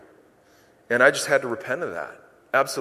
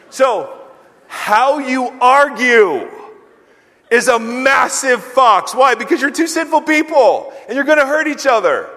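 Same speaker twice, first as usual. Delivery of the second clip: preaching shout; 8 semitones higher, 6 dB louder than usual. Real, not acted. Pastor shouting the warning to the congregation. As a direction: bear down and shout it.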